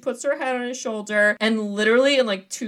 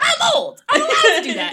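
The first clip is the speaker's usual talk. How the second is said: much higher, louder retold protest with laughter in it; indignant mock yell; 6 dB louder than usual. Grandpa defending himself to his family, high mock-indignant shout.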